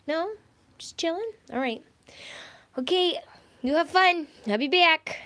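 high, loud sing-song pet talk; talking to a dog. Real, not acted. Greeting her dog Lulu.

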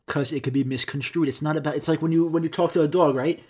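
ordinary talk, nothing marked

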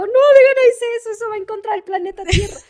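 high, loud exasperated self-reproach; mock self-scolding. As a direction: scold yourself like a nagging conscience. Scolding herself over a brand deal.

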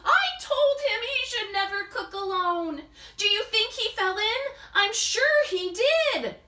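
panicking that he fell in; worried friend. Acted. Friends fear Max fell in.

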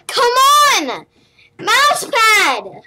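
loud, high, strained cries; frustrated shouting. Real, not acted. Gamer angry at his slipping mouse, loud frustrated cries. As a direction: yell in exasperation.

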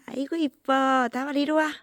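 high affectionate coo; cooing at the dog. Real, not acted. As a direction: coo lovingly, pitched high.

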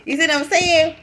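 laughing, high and loud outburst; loud, amused, riled-up alter ego; worked up. Real, not acted. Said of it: "Keisha getting worked up".

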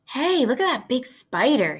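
put-on loud, high excited boy. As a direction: point it out thrilled. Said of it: "he said".

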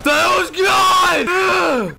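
very high, drawn-out yell; high, loud, stretched scream; screamed outburst. Pretend. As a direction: shriek it slowly at full voice. Screams as he is attacked.

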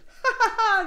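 loud, high cackling laugh; high, hearty ha-ha-ha; burst of laughter. A narrator's reading. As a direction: laugh out loud like gleeful little pigs. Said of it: "riaient aux éclats".

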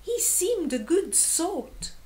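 put-on high, surprised young voice; small warthog's shocked squeal of words; startled little warthog. A narrator's reading. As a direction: cry out high in innocent astonishment. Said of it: "crying out with a snort".

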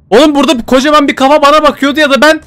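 high, loud panicked rush; scared. Real, not acted. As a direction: blurt it out still terrified. (korkudan telaşlı, yüksek sesle)

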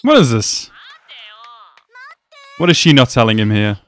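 ordinary talk

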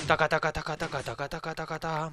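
tableteo de "taca" muy seguido; ruido imitado. Acted. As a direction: imita una máquina repitiendo sílabas. Imita el ruido del mecanismo. (traqueteo mecánico con la boca)

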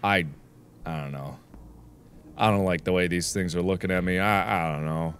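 soft uneasy murmur; quiet. Real, not acted. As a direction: say it softly, uneasy. Scared of creepy level.

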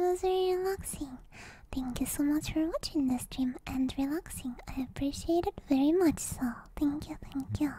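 soft breathy whisper close to the mic; ASMR whisper. Acted. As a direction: whisper as if lulling viewers to sleep. Whispered ASMR stream chat.

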